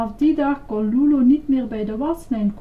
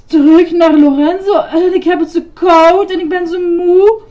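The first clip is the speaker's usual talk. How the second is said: loud, high, teeth-chattering wail; shivering; 9 semitones higher, 12 dB louder than usual. A narrator's reading. Grover cold and tired from the pond, shivering. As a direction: stammer it through hammed-up shivers. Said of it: "bibberde Grover".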